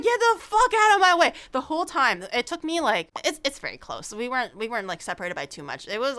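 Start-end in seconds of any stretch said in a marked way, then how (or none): 0.0-1.3 high, loud pushy shout while running; sprinting to the bar, yelling at people; mock angry yell. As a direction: barrel through the crowd. Sprinting to rescue.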